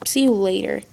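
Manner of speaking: ordinary delivery, nothing marked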